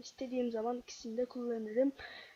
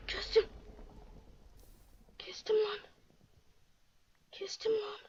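breathless whisper; 7 semitones higher, 5 dB quieter than usual. Real, not acted. Just won the fight.

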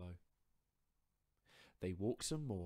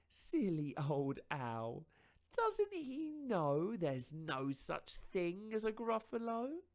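higher, louder, teasing mouse voice; smug little mouse; 11 semitones higher, 8 dB louder, slower than usual. Acted. Mouse mocking the fled fox, higher and smug.

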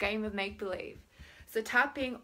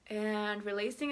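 usual speaking voice

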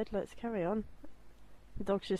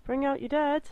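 loud, higher crier's call; mock town crier shout; plague cart call; 6 semitones higher, 5 dB louder than usual. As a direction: bellow it like a cart man collecting bodies. Monty Python plague cart call.

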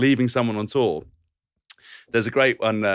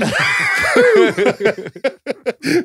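loud high laugh breaking out; shouting laugh; 7 dB louder than usual. Real, not acted. Coach's outburst retold.

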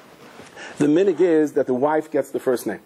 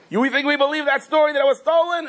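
much higher, mocking disbelief; accusing; 12 semitones higher than usual. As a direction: scoff at the excuse. Creditors accuse him.

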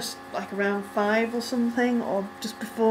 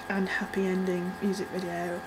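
whispered aside; 3 semitones lower, 7 dB quieter than usual. Real, not acted.

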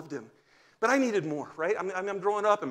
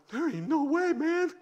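put-on high, scoffing kid voice; a boy brushing it off, pitched up high; high mock protest of an eleven-year-old; kid refusing; 11 semitones higher than usual.

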